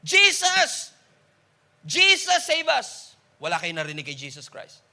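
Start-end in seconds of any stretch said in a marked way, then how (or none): 0.1-3.2 slow, high and loud acclaim; crowd shouting. Retold or imitated. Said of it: "some of them are shouting".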